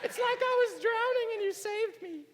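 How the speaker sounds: put-on high falsetto girly voice; man doing a woman's voice, high; girl voice. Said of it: "That's how girls sound".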